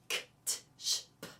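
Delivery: unvoiced K, t, sh, p as breathy pops; voiceless consonants. Shown as a demonstration. Teacher showing plosives that carry no pitch, voiceless. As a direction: spit out K, t, sh, p with no pitch. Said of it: "consonants that are plosive, that do not carry pitch".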